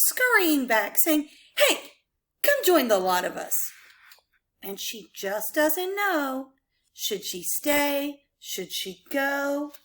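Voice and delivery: animated rhyming story narration; picture book read-aloud. Acted. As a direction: read it to children with bouncy rhythm and dropped refrains.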